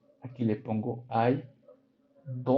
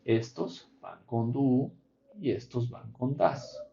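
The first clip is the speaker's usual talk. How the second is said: slow teaching; slower than usual. Shown as a demonstration. Maestra dicta las dos reglas.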